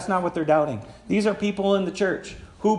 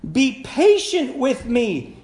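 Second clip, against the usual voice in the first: raised, drawn-out plea, narrated; begging; 7 semitones higher, 5 dB louder, much slower than usual. A narrator's reading. Servant on his knees before the master, begging for patience. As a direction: plead slowly, high and loud, as the storyteller. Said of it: "he begged".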